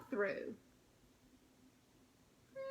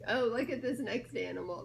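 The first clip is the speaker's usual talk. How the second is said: ordinary delivery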